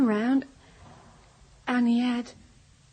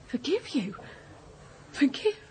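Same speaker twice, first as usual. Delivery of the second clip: tearful disbelief at being asked forgiveness; 4 semitones higher, 4 dB quieter than usual. Acted. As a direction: question it through rising tears. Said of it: "please stop crying".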